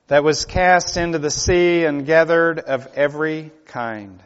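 loud, ringing pulpit delivery; sermon voice building to full force; preaching. Acted. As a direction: proclaim it to the congregation. Preacher reads scripture, expounds.

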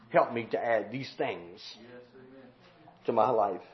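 low, soft plea to God; quiet prayer. Acted. Believer asking the Lord for help, quiet prayer. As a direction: pray it humbly, low and hushed. Short prayer after the outburst.

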